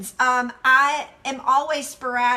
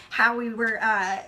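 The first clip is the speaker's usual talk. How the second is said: normal talking voice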